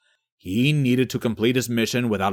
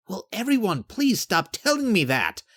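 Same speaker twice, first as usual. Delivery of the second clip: higher-pitched, fed-up outburst; frustrated, rising-pitched complaint; exasperated plea; 9 semitones higher than usual. Acted. Jake fed up with remark.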